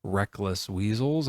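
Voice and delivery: ordinary talk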